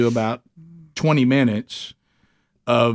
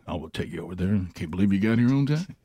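lower, softer, easy radio-man delivery; Dan's laid-back radio voice; 7 semitones lower, 6 dB quieter, faster than usual. Retold or imitated. Dan the driver greeting Rick, low laid-back radio voice. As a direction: lay back like an old DJ. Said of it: "There ain't nobody more laid back than Dan".

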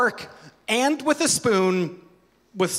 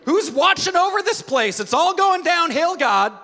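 whining believer; 7 semitones higher than usual. Retold or imitated. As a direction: plead like a toddler with God. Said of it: "we're like".

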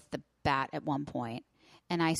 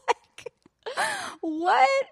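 incredulous; 4 dB louder than usual. Real, not acted. Disbelief at own story.

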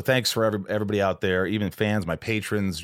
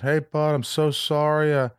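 imitating the friend's message, higher and slower; a pitched-up, sympathetic mock voicemail; Harlan's voicemail; 10 semitones higher, 4 dB louder than usual. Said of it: "he always leaves a message".

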